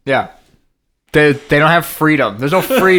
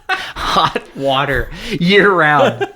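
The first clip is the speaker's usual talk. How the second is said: very slow, amused mock disbelief; slow teasing drawl; 5 semitones higher, much slower than usual. Real, not acted. Teasing the host about drinking hot water, slowly drawn out. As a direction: draw each word out teasingly.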